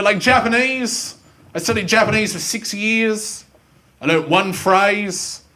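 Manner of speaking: ordinary talk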